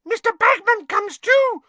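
very high, loud elf squeak; startled elf squeaking out; shrill squeak of surprise. A narrator's reading. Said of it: "squeaked Winky".

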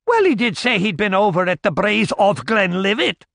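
a hint of a gossiping old crone; old village woman. Old woman gossiping to the barkeep, lightly voiced.

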